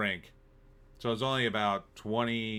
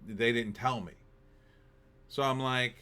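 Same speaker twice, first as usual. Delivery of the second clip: normal talking voice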